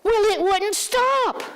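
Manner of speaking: put-on elderly mother insisting to her daughter, high and slow; mother's defensive reply. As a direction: defend yourself like a stubborn parent.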